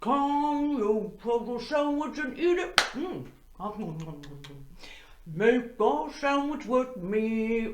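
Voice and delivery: singing through a pretend mouthful, words mushed; mouth full. Teacher pretending to eat while singing, mouth full. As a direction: sing as if chewing a big bite. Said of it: "talking with my mouth full".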